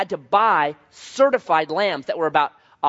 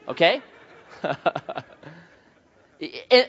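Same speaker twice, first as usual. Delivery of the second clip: normal talking voice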